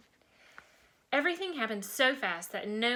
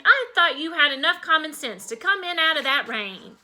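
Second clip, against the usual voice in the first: loud, higher-pitched cross mother; exasperated mom telling off her kid; exclaimed scolding; 5 semitones higher, 7 dB louder than usual. A narrator's reading. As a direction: scold a child with raised voice. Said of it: "she exclaimed".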